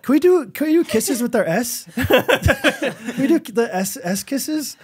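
put-on high, innocent kid voice; dad's high-pitched mimicry of a child; little boy imitation. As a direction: ask it like an eager small son, half laughing. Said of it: "sounds like he's saying something else".

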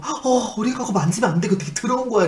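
high-pitched retold alarm; startled warning. Caretaker startled as patient touches mothballs, alarmed warning. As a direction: warn a child, taken aback. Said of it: "그 사람 너무 놀래가지고".

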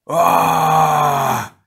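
long, high loud scream; drawn-out wail of alarm; loud cry. A narrator's reading. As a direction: scream as the rocks fall. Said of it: "the smoky stranger cried".

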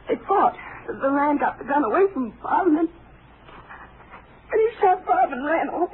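put-on tearful girl telling the ranger. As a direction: let the tears come on the shooting.

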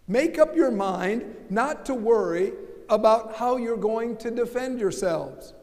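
usual speaking voice

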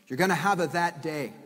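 normal talking voice

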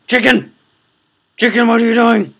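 high, loud shout at a misbehaving chicken; alarmed yell. Real, not acted. Player scolds a chicken going for the diamonds, alarmed yell. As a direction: yell out in sudden outrage.

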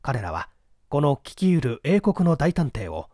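ordinary delivery

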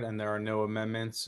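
ordinary talk, nothing marked

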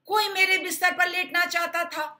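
high and loud papa bear; papa bear indignant. Acted. Papa bear finds his bed slept in, loud. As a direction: call it out in alarm. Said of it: "पापा भालू बोले".

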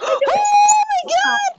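high shouted gasp of surprise; shrieking gasp. Real, not acted. Game works, shouted gasp.

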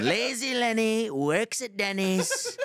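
high, drawn-out mocking heckle; Donald Trump. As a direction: stretch it into a slow taunting nickname chant. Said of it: "I start Trump heckling him".

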